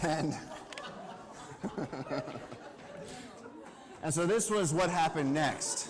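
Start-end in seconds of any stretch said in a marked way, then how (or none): 0.4-4.0 airy breathless laugh. Real, not acted. Speaker laughing at his own wild story, airy and breathless. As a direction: let the laugh run out of breath.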